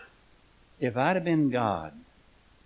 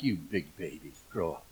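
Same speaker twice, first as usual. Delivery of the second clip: lower, softer mock scorn from the wife; wife's scornful look; 11 semitones lower, 10 dB quieter than usual. Preacher voicing his wife's look at him, low mock scorn. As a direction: voice the wife's withering glance, low and quiet. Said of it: "she looked at me like".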